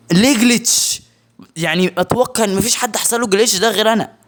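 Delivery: high, loud complaining; indignant outburst. Real, not acted. Gamer complaining to viewers, high indignant rant. Outraged at the glitch.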